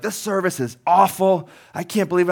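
high, loud mock rant at the cashier; a customer reaming out the worker; imitating a furious customer complaining about service; irate customer. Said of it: "And they're like".

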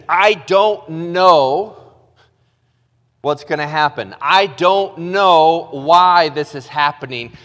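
loud, drawn-out plea; impassioned. Acted. Pastor voicing the psalmist's doubt, loud and impassioned. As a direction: pour it out with urgency. Passionate climax of sermon.

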